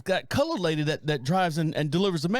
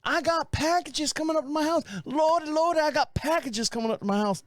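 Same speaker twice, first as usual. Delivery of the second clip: put-on old Southern gentleman voice, pitched higher; 7 semitones higher than usual.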